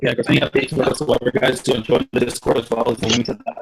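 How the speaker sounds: glitchy robotic audio; robotic mic. Real, not acted. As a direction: voice breaking up like a bad mic. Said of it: "Your mic is robotic".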